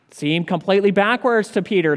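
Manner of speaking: ordinary delivery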